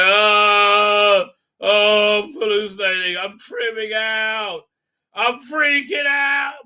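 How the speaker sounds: rising panicked yells, slowing down; mock freak-out, building to a shout; hammed-up panic, loud and stretched. Character panicking at hallucination.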